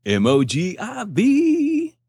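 high, slow letter-by-letter spelling; spelled out slowly. Shown as a demonstration. Spelling the sponsor name.

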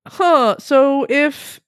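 very slow, drawn-out thinking aloud; pondering aloud as she quotes a friend's odd logic; slow musing. Retold or imitated. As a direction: puzzle it out word by word. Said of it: "Peter's like".

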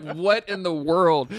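slow, stretched out in disbelief; drawn-out what in the world; bewildered inner thought. Retold or imitated. Narrator recalling his dad's clip question, slow bewildered aside.

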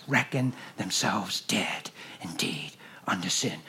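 low hushed whisper. Acted. Preacher whispering God's plan to the church. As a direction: whisper it like a sacred secret.

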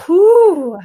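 big blown-out whew; loud whew exhale. Real, not acted. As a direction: release it with a loud breath. Exhale after confession.

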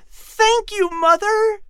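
put-on high, loud, joyful child's thanks; boy hugging his mother, thrilled; excited young boy. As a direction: burst out thanking her.

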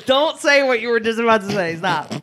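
high, loud laughing plea; loud laughing protest, cut him off. Real, not acted. Stopping friend from oversharing, laughing.

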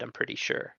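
under the breath, unsure; whispered aside. Real, not acted. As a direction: whisper it as a hedge. Unsure aside after explanation.

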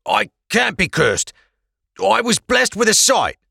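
high, thin voice of a frightened prisoner; pleading prisoner voice. Acted. Prisoner pleading with the Blackcoats, high and anxious.

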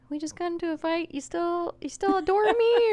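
high, rising pleading voice; needy partner. Retold or imitated. Girlfriend mimicking herself needing reassurance after a fight. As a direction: beg for reassurance like an anxious partner. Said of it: "it's like".

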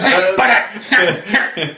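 slow cartoon slacker with a snickering laugh; put-on dopey cartoon teen; Beavis. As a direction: drag it out in a goofy cartoon voice. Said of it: "as Beavis and or Butthead".

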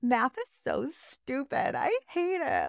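put-on high, slow, whiny teen rant; sulky kid complaining about math class; mock seventh grader. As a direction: ham up a melodramatic middle schooler. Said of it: "being in seventh grade and being like".

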